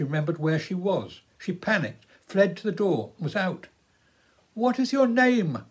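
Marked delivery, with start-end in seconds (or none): 4.5-5.6 calling out. A narrator's reading. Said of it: "called Philip".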